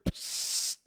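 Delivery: long psst of escaping air; imitated air hiss. Driver telling what he heard from the turbo, imitated hiss. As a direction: mimic a drawn-out pressure hiss.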